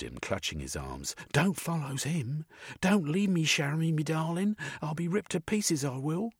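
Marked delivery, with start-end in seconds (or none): 1.3-6.3 high, frantic Cockney woman begging; pleading Missus. A narrator's reading. Missus clutches Jeremy, begging him not to leave her.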